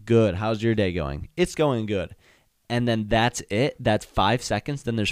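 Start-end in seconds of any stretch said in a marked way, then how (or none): none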